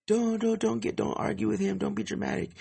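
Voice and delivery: squeaky mocking imitation of a clingy drunk girlfriend. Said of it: "my dumbass girlfriend".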